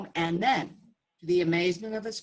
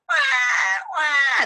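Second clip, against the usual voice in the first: higher hammed-up baby wails; mock crying; 11 semitones higher, 7 dB louder than usual. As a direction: wail like the children for laughs. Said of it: "the children".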